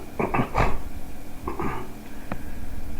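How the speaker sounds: sharp involuntary hiccup; a real hiccup jolting out. Real, not acted. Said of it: "I've been hiccuping nonstop".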